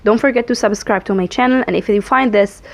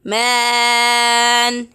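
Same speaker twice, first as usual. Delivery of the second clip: pinching nose; much slower than usual. Shown as a demonstration. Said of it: "when I hold my nose".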